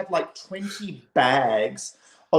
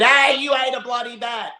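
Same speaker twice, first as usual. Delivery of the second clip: high, raised accusing call; hammed-up loud accusation; mock accusing shout; 9 semitones higher, 4 dB louder than usual. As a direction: call it out like a heckler. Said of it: "if you said".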